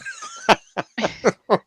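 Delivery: high-pitched real laugh; helpless burst of amused laughter. Real, not acted. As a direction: laugh out at the joke. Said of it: "I'm just laughing".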